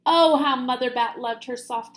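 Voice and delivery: usual speaking voice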